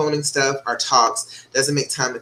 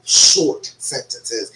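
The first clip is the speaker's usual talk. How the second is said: ordinary talk, nothing marked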